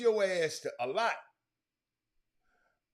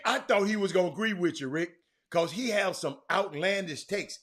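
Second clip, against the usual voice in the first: usual speaking voice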